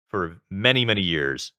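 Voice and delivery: ordinary delivery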